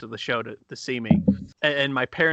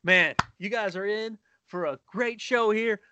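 mock MC building from higher pitch to a drawn-out shout; stage MC introduction; 10 semitones higher than usual. Host bringing the comic to the stage, hammed-up announcer build. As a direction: build the hype, stretch the final name high. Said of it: "So he gets up on stage".

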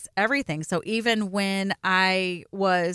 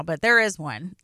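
ordinary talk

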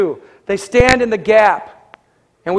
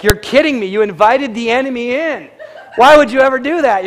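imitating shocked neighbors, higher and louder; voicing the neighbors' shock, rising to louder; mock disbelief; 6 semitones higher, 4 dB louder than usual. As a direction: build up to an incredulous cry. Neighbors' shocked reaction voiced.

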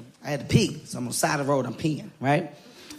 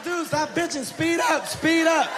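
friend's yell; 9 semitones higher, slower than usual. Retold or imitated. Friend yelling at scared driver.

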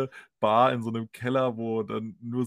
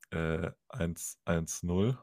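softer, very slow, lower eins eins null; slow patient answer; 6 semitones lower, 5 dB quieter, much slower than usual. Retold or imitated. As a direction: spell out eins eins null slowly as to a fool. Operator slowly gives number.

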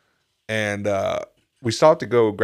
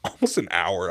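usual speaking voice

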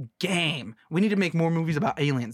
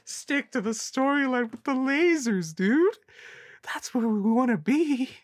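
squeaky high dude voice; high goofy voice; 9 semitones higher than usual. Pretend. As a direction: say it high and silly like a surfer. Repeats the line higher and sillier.

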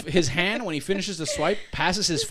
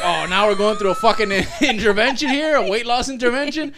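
louder, higher mock outrage; sarcastic protest; 7 semitones higher, 7 dB louder than usual. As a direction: scoff at the intervention.

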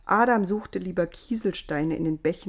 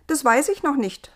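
higher, brighter voice for Eva; newcomer Eva, cheerful; 8 semitones higher, 6 dB louder than usual. A narrator's reading. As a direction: play the curious newborn girl.

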